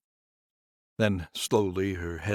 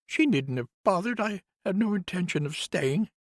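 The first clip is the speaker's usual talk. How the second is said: much higher, louder woman's voice, bitter; grieving nurse; 13 semitones higher, 4 dB louder than usual. Acted. Nurse complains to Conrad about the house, higher voice. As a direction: lift into the nurse's aggrieved voice.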